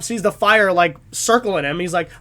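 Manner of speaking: ordinary delivery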